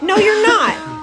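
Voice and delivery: high, loud disbelief; incredulous Joey. Retold or imitated. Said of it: "He's like".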